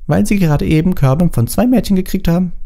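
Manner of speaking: lightly voiced girl, pitched well up; high, girlish explaining; Ginny's girl voice. A narrator's reading. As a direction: tell on them in a high voice. Ginny voiced high over the boys.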